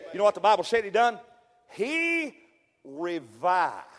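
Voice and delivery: slow build to a loud cry of revival; rising sermon climax. Acted. Preacher building to the revival climax for the church.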